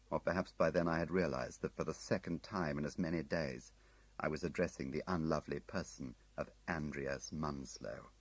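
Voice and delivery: plain reading voice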